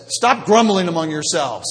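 a loud, firm "Stop grumbling among yourselves"; loud rebuke. Retold or imitated. Preacher voicing Jesus scolding the grumbling crowd, loud rebuke. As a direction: hit it like a sharp scolding.

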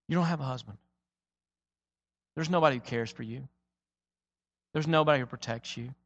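soft and low, gently laying bare her loneliness; tender, quiet. Acted. Jesus gently telling the woman she has no one, soft and low. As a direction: say it softly, like a painful truth told with care.

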